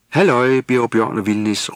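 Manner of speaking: calling out. Acted. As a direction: hail them from a distance. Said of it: "råbte Jule".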